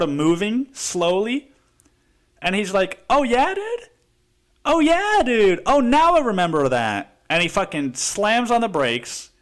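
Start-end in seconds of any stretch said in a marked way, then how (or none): 3.1-7.2 higher voiced driver, sarcastic recognition; lightly voiced driver going oh now I get it; sneering realization, hammed up; mock driver realizing. Imitating the driver recognizing him.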